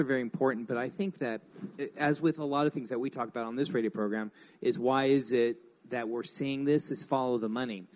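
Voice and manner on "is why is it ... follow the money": ordinary delivery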